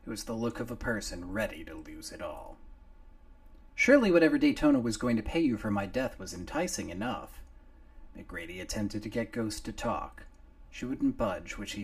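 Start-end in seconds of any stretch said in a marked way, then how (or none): 3.8-7.4 fast, loud, goading; gruff hero voice. Acted. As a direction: needle her, quick and hard. McGrady taunts Ghost.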